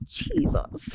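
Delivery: breathless unvoiced Jesus; horrified whisper. Teller hearing them drop her candidacy, a horrified whisper. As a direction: gasp it under your breath.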